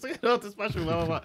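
a light, offhand Toad impression; imitating Toad. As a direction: squeak it softly like a little game character. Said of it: "freaking Toad pops up".

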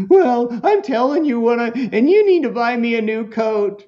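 put-on high, loud kid insistence; demanding kid. Son insists Mom replace his coat, demanding. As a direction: imitate a child pressing a demand on a parent.

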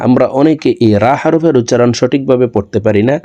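usual speaking voice

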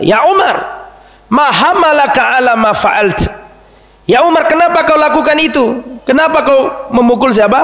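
high, pressing question to Umar. Acted.

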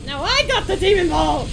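loud, high triumphant yell; excited shout. Real, not acted. Gamer shouting over a new power-up, excited. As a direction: shout it out in victory.

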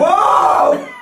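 loud, high whoa; startled shout. Real, not acted. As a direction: shout from the sudden cold. Cold water plunge.

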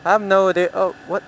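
ordinary delivery

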